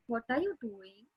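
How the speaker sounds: soft, gentle caring question; empathetic. Shown as a demonstration. Teacher demonstrating a caring, empathetic tone. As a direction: ask it softly, with concern.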